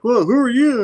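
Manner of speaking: high, loud mock surprise; startled quote. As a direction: act taken aback by a stranger. Acted startled first meeting.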